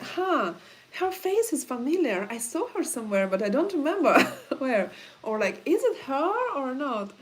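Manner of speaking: mock someone half-recognizing her; puzzled onlookers. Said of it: "Some people like".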